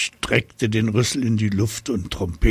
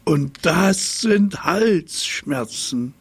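haughty giraffe; 7 semitones higher, slower than usual. A narrator's reading. Giraffe declares proudly.

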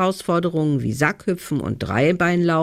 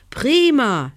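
bright burst of praise, high and louder; praising; 9 semitones higher, 5 dB louder than usual. A narrator's reading. Judge praises the boy for his cherry-stone spit, delighted. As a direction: cheer the child's result, pitched up. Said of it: "lobte ihn die Frau".

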